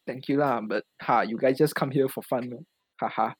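usual speaking voice

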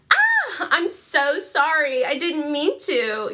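put-on high, slow Valley Girl squeal; apologetic Valley Girl, drawn out; Valley Girl accent. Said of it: "say all of her parts now in a Valley Girl accent".

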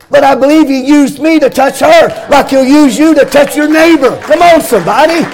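chanting sermon climax, voice ringing over the organ; full-force preaching. Acted. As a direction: whoop it up like a revival preacher.

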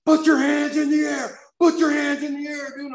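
imitated police shout. Cops swarming a passenger at the airport, high shouted order. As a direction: stretch each order out at full mock-cop volume.

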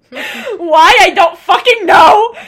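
loud, high, half-laughing why; exasperated laughing outburst. Real, not acted. Telling her friend she cried for no reason, loud laughing exasperation. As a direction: throw up your hands, laughing loud. Recounting crying during sex.

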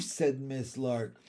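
ordinary delivery

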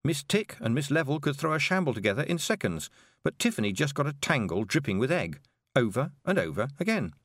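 plain reading voice

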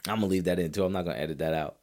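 normal talking voice

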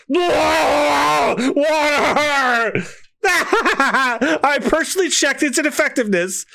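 high yelp cut with laughter; laughing mock scream. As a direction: scream for water, cracking up. Throat on fire screaming.

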